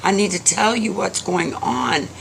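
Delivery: put-on high-pitched child's plea; beg, with a touch of crying; tearful pleading. A narrator's reading. Little boy begs old man for soup, tearful. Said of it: "the little boy begins to cry".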